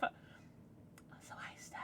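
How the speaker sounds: whispered hello. Real, not acted. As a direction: breathe the greeting without voice. Aside greeting to a viewer.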